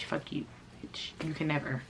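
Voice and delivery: whisper. Retold or imitated. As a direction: breathe the insult out under your breath. Whispered aside in the rant.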